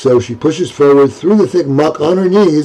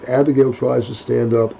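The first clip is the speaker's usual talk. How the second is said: ordinary delivery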